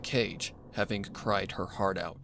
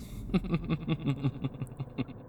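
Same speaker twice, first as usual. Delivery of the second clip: a higher, cold villain's laugh; cruel laugh; 5 semitones higher than usual. Acted. The cult laughs as the filly eats, cold and cruel. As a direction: laugh at her horror.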